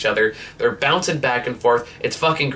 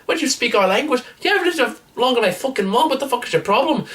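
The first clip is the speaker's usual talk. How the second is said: high, far-off put-on voice for an indignant teen; band member's outburst; 13 semitones higher, faster than usual. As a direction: squeak it out, indignant and fast. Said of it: "And he goes".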